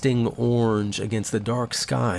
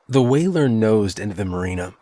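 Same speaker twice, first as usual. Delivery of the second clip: ordinary delivery, nothing marked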